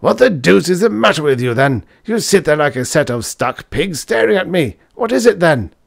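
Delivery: loud, high-pitched scolding; angry shout. A narrator's reading. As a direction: berate the students in exasperation. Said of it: "shouted the professor angrily".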